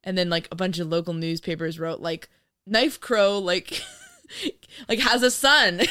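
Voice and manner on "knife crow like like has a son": laughing headline. Retold or imitated. Mock newspaper headline.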